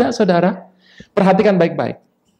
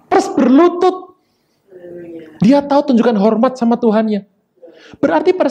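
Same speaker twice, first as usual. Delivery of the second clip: high, fervent preacher's voice; impassioned preaching; 10 semitones higher than usual. Acted. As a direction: preach it with full fire. Preaching at full force. (khotbah berapi-api)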